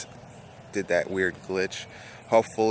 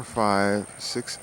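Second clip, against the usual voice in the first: ordinary talk, nothing marked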